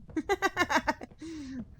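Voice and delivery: high burst of laughter; a real, quick cackling laugh. Real, not acted. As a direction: laugh out loud, high-pitched. Amused by chat on the accent.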